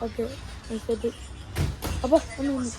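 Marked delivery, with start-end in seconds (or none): none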